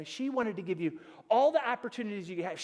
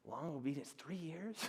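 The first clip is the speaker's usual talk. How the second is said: daunted; 6 semitones lower, 14 dB quieter than usual. Retold or imitated. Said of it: "I'm like".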